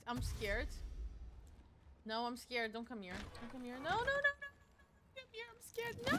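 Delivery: hammed-up rising fright; mock panic. Enemies approaching in fight.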